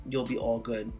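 usual speaking voice

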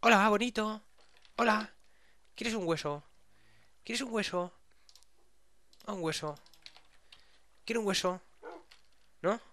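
vocecita aguda y mimosa; ofrécele un hueso al perrito con voz alta; hablando a mascota. Pretend. Habla a un animal del juego. (tono agudo para animales)

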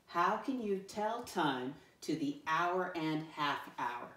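slow, evenly paced group reading; read-along. Shown as a demonstration. Teacher reads the question with pupils, slow read-along. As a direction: read slowly so everyone can join in.

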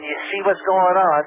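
ordinary talk, nothing marked

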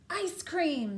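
high, loud bratty princess yell; shouted demand. A narrator's reading. Reader voices princess demanding ice cream, shouting. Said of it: "she shouted".

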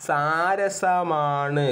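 very slow, syllables stretched; drawn-out answer. Shown as a demonstration. Teacher giving students the answer word, drawn out slowly.

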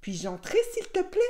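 coaxing, falsely polite request; feigned niceness. A narrator's reading. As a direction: pretend to be nice, lilting high on the greeting and the plea. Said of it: "Il fit le gentil".

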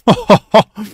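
a loud, high burst of disbelieving laughter; shocked high laugh. Real, not acted. Stunned by on-screen violence.

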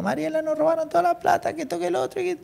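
high-pitched, upset telling; mock panic to the wife; distressed. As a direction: tell the wife the money is gone. Telling wife money stolen.

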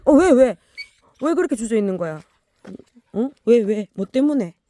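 loud high puzzled questions; loud high what's-wrong calls; calling to the dog. Real, not acted. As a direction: ask the dog loudly why it stalls. Asking the hesitant dog.

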